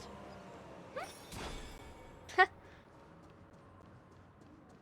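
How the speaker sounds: a high, breathless wheezing laugh; wheezy laugh. Real, not acted. Streamer wheezing with laughter after a miss. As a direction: laugh with no voice behind it.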